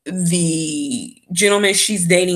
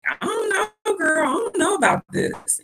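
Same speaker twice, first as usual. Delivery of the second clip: high, softened mock hesitation; imitating doubtful friends; 12 semitones higher, 3 dB quieter than usual. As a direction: voice wary girlfriends in a high pitch. Voices doubtful friends reacting to her plan.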